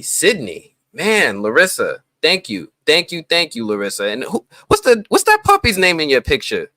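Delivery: usual speaking voice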